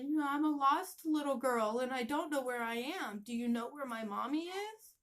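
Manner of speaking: put-on high, loud childlike plea; lost little girl. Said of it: "and I said".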